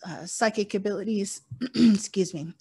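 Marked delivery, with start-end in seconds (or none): 1.8-2.5 allergies catching the throat; throat clearing. Real, not acted. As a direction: clears throat, then excuse me. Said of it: "my allergies are like, no bueno".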